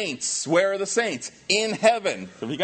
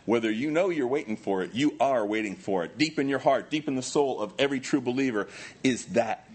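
ordinary delivery

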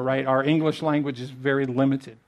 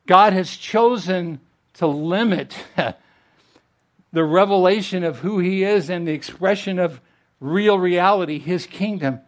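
rising preaching; 4 semitones higher, 5 dB louder than usual. Real, not acted. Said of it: "preaching builds".

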